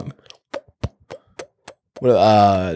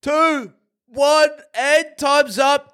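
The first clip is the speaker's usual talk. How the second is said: game show countdown; 9 semitones higher, slower than usual. Acted. Quiz host countdown.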